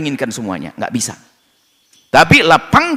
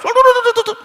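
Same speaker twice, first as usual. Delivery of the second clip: mock dismay; 4 dB louder, much faster than usual. Reacting to loud-talking tribe.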